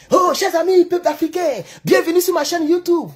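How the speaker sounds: lightly mock a sweet YouTuber intro; friendly YouTube greeting. Vlogger greeting his African viewers in a lightly mocked sweet intro voice. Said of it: "on fait des vidéos et puis on dit".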